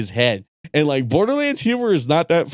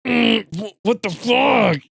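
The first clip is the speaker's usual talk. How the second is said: imitated grunting and groaning; much slower than usual. Mimicking friend losing at the game, grunting and groaning. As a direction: groan into the words like a struggling gamer. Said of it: "hear him grunting and groaning".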